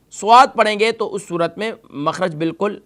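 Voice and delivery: ordinary talk, nothing marked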